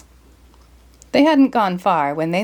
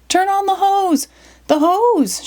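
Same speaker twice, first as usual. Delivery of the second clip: much higher frantic yell; Shaggy yelling; 11 semitones higher, slower than usual. Acted. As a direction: scream for the hose in a panic. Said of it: "Shaggy yelled".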